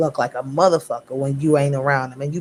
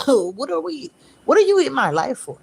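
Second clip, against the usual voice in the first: higher, half-joking fed-up voice; acting out a fed-up friend questioning someone; mock exasperated question; 8 semitones higher than usual. Reader voices a fed-up inner question.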